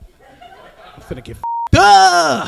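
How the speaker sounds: high, loud, slow gleeful whoop; drunk party cheer. Acted. Drunk friend grabbing the vodka, high and loud. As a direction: crow it with wild glee. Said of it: "She be like".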